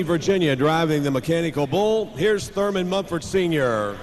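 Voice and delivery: ordinary talk, nothing marked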